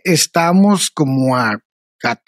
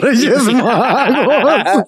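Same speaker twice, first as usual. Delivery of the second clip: laughing; 4 semitones higher, much slower than usual. Real, not acted. Laughing while naming the topic.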